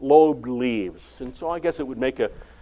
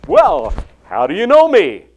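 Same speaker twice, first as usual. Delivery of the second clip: surprised question; 3 semitones higher, 10 dB louder than usual. Shown as a demonstration. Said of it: "Does Nathanael say".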